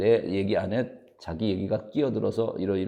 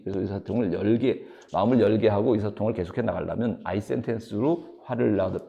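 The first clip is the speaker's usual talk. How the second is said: ordinary talk, nothing marked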